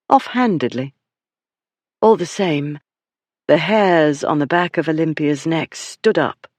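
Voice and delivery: ordinary delivery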